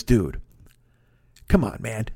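lightly voiced pal, talking him into it; low coaxing buddy. Quoting his pal urging him to come along, low and coaxing. Friend's coaxing reply quoted.

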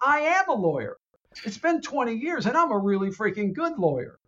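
high, indignant assertion; insist, offended; indignant insistence. Retold or imitated. Gabriel defending herself to Constantine, high indignant insistence.

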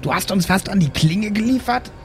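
usual speaking voice

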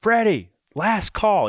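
high, loud, drawn-out call of the name; mock yelling a name. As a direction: imitate the desk clerk yelling a last call. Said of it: "the guy at the desk is yelling".

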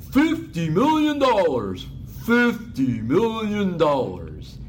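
loud, slow, booming repeat; Ballmer impression. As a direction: bellow the sum slowly, stunned. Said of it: "I do a good Ballmer".

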